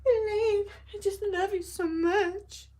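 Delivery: high, pleading lovesick voice; lovesick man. Retold or imitated. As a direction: beg her like a hopelessly smitten man. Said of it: "He literally screams for her".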